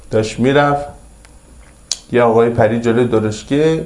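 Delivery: normal talking voice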